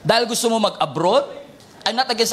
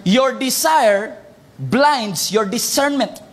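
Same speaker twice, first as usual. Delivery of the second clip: slow emphatic reading; slow and deliberate; much slower than usual. Shown as a demonstration. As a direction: read the key phrase slowly. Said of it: "Look at this. This is the phrase".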